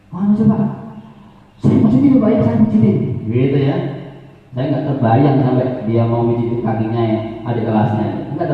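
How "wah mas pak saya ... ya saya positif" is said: high, eager pupil voice, lightly voiced; eager older pupil. Older pupil offering teacher a positive answer, eager. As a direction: answer keenly like a willing schoolboy.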